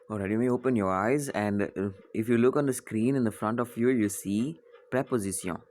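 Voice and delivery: ordinary talk, nothing marked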